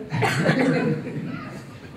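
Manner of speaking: high-pitched squealing laugh. Real, not acted. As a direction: burst into a high giggle at the irony. Laughs at the irony.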